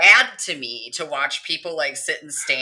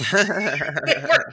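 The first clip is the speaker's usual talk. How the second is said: laughing; 5 semitones higher than usual. Real, not acted. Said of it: "I can't say it with a straight face".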